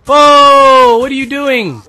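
loud, high, drawn-out whoa; startled yell. Real, not acted. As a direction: blurt it out in alarm. Startled by play in game.

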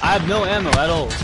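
furious panicked shouting; panicking gamer. Real, not acted. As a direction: yell it high and loud. Out of ammo, under fire.

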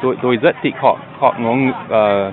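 halting learner Vietnamese, full of pauses; searching for words, American accent; foreigner broken vietnamese. Real, not acted. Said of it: "nói tiếng Việt Nam rất dễ thương". (tiếng Việt bập bẹ của người nước ngoài)

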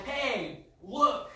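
calling out to get attention; loud call. Shown as a demonstration. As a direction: call out to grab the room. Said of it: "really loud".

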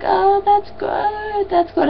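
soft, high, drawn-out sleepy imitation; slow, sleepy mock congratulations; just-woken friend. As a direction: play someone groggily half awake on the phone. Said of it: "I actually woke her up".

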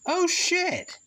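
high, startled curse; real high-pitched alarm at a blunder; dismayed outburst. Real, not acted. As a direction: blurt it in sudden dismay. Realises recording was paused.

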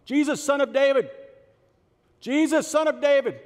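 loud, high shout to someone passing; yelling. Acted. Blind beggar calling to Jesus as he passes, yelling. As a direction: call out at full voice over a crowd. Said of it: "He just starts yelling".

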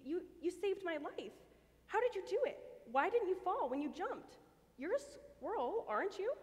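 astonished and grateful; Sally amazed at the flying squirrel, breathless; amazed questions. Acted. Amazed after rescue.

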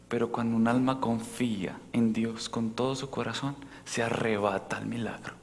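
susurra con ternura y lento; susurro. Real, not acted. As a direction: baja la voz a un susurro íntimo. Susurro en plena prédica. (susurrando con ternura)

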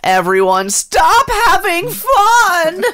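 high, slow whining scold; whiny Rabbit voice. Retold or imitated. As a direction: wail it like a fussy killjoy. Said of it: "I'm fucking Rabbit".

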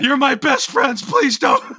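very high, loud mock begging; frantic pleading. Clarence begging his friends not to kill him, very high, loud pleading. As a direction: beg for your life in a squeaky, loud panic. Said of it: "Clarence started freaking out".

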